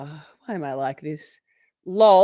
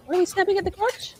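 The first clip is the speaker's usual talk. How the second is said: incredulous squeal; 13 semitones higher, faster than usual. Real, not acted. Incredulous reaction.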